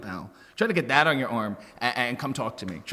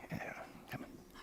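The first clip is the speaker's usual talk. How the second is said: whisper; 26 dB quieter than usual. Real, not acted. Guest brushing off compliment.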